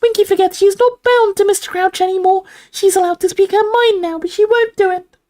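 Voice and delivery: very high elf squeak; confidential squeak. A narrator's reading. Dobby confides in Harry, confidential squeak. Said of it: "squeaked Dobby confidentially".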